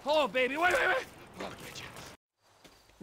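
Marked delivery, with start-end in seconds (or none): 1.4-3.0 slow, much softer whisper. Acted. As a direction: breathe the promise under your breath. Man chasing her, aside.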